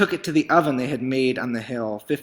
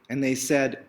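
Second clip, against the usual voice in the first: plain reading voice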